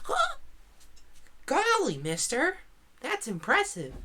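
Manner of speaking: put-on high-pitched, wide-eyed little boy voice; impressed mine kid. Game master voices the mine boy to the players, high and impressed. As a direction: gush like an awestruck kid. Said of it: "And he goes".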